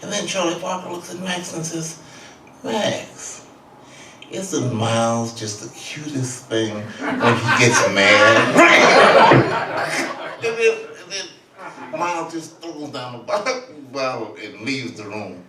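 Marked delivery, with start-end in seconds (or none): 2.6-10.1 slow, laughing through it; mocking tease. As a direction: laugh off the angry Miles. Parker mocking Miles.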